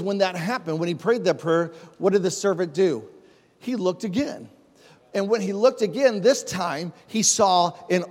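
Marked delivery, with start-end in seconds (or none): none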